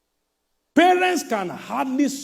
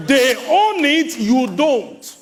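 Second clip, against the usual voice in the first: higher, louder, slow and emphatic; forceful preacher punch; 5 semitones higher, 6 dB louder, slower than usual. Acted. Preacher telling rich children they own nothing, in a forceful slow shout. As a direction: hammer home the point slowly.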